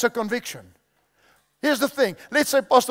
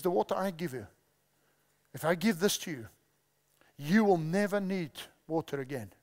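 softer, lower, measured delivery; gentle quoted Jesus; 5 semitones lower, 8 dB quieter than usual. Retold or imitated. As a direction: speak Jesus's promise quietly, with pauses. Preacher voices Jesus to the woman.

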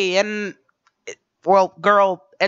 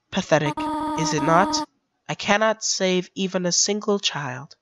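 gentle goat mother; 6 dB quieter than usual. Acted. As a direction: coax the child softly to go upstairs. Toriel voice again.

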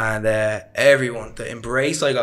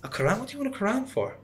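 imitating a friend's shocked squeak, much higher; retold disbelief, pitched way up; 12 semitones higher than usual. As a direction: play the classmate floored by the request. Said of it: "And he was shocked".

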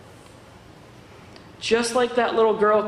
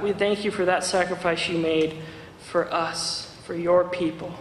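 closing prayer. Acted. Preacher leads the church in closing prayer, hushed and earnest. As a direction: pray humbly over the congregation.

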